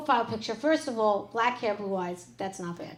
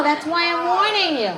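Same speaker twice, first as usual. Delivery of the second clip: loud, high warning shout; mock warning; 6 semitones higher, 10 dB louder than usual. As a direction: bellow the warning at the crowd. Loud mock warning, then repeated.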